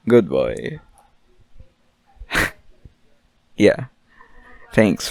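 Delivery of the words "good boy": put-on deep good boy. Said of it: "praising listeners".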